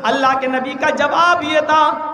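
loud, high, sustained oratory; full-force preaching. Real, not acted. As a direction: proclaim the hadith with long drawn-out words. (बुलंद आवाज़ में खींचकर तकरीर)

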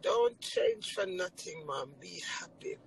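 slow, soft daughter's wish; tender echo. Retold or imitated. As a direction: say it gently, fading to a whisper. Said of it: "she said me nothing change".

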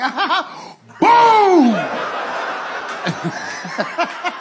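high mock laugh bursting into a boom; laughing wife impression. Imitating the wife laughing till the tank explodes, mock gleeful. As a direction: cackle up high, then blow up loud. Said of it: "She'd be like".

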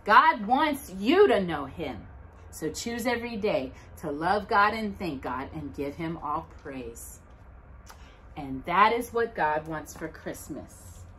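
usual speaking voice

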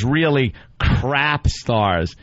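slow, drawn-out stress on "crap"; mock quoting. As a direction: stretch "Really crap stars", leaning hard on "crap". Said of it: "and then he goes".

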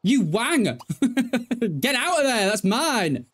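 half-laughing fake fury at the game; mock outrage. Real, not acted. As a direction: yell it like a playful sore loser. Someone took her dream house.

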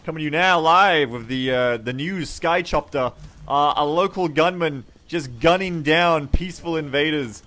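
loud, urgent live-report voice; mock on-the-scene reporter, projected; news reporter. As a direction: report breaking news from a helicopter.

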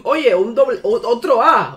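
high, loud burst of excitement; thrilled surprise. Real, not acted. Streamer landing another A, thrilled surprise. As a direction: break off, exclaiming in real astonishment.

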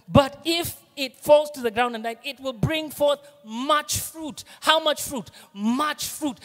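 rising pulpit proclamation; preaching at full force. Acted. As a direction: lift it and drive the promise home.

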